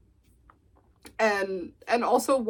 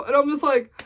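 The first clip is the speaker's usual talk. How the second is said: ordinary delivery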